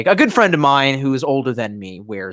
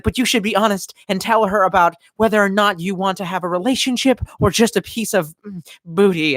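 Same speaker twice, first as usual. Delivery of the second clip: slightly raised, imitated motherly lecture; lightly voiced mother; 6 semitones higher than usual. Mother advising on honesty with women, lightly imitated lecturing. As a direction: lightly imitate a mom giving earnest advice.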